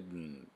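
"didn't" with the D gliding straight into N; tongue stays on the ridge through "didn't"; quick, relaxed "didn't"; native didn't. Shown as a demonstration. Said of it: "didn'tみたいな感じで".

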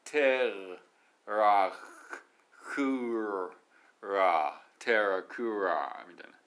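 "テ" "ラ" "ク" "ラ" each loud and separate; separate syllables. Shown as a demonstration. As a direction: punch out each syllable of "テラクラ". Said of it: "テラクラの方が早いよね".

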